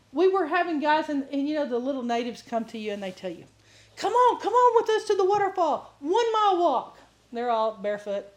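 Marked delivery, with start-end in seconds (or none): 4.0-7.0 high, eager imitated call with a laugh; native guide's call. Preacher mimicking natives urging a walk, eager and high. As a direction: mimic the locals' cheerful invitation. Natives inviting them, retold.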